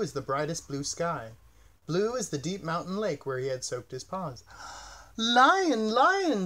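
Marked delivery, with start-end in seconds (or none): 4.5-5.1 soft unvoiced gasp of delight; enchanted gasp. A narrator's reading. Bird sees the blue tail, gasping in enchantment. 5.2-6.4 high, loud delighted call; enchanted chirp. A narrator's reading. Bird sees the lion's blue tail, calling out high and enchanted. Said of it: "the bird chirped, enchanted".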